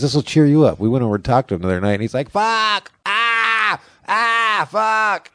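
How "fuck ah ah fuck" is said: high-pitched cries, imitating a hurting brother; imitated pain yelling. Brother with hurt hip cursing, imitated high yelling. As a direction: yelp curses in a high voice. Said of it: "Dude, stop yelling".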